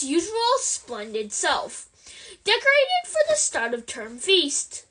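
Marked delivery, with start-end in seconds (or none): none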